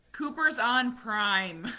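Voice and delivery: loud, slow, high-drawn warning; alarmed announcement. Retold or imitated. Host's message about Cooper renting, mock alarm. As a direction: read the alarm as a mock shout.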